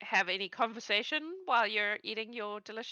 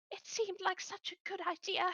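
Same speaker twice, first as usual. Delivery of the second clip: higher, softer rueful aside; sheepish goblin; 10 semitones higher, 7 dB quieter than usual. Acted. Goblin regretting his plan, a touch sheepish. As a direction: admit it with a wince. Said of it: "And he goes".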